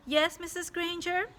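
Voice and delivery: put-on high, sweet innocent kid voice; innocent boy. A narrator's reading. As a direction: sound like a little angel. Child answers teacher innocently.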